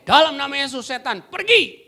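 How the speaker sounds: loud, high command to the demon; commanding exorcism. Retold or imitated. Preacher casting out a demon, loud command. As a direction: order the demon out with authority. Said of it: "Saya pakai".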